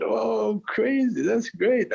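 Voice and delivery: high and bright with delight; excited. Retold or imitated. Excited retold greeting, higher.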